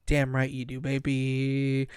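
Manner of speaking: low, smooth cocky lover voice; smug seducer.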